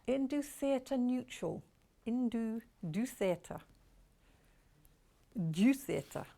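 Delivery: a light go at a French accent; slow, careful attempt at French pronunciation. Shown as a demonstration. Trying out a French name on the fabric for viewers, lightly French-accented. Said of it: "That's my lovely French accent".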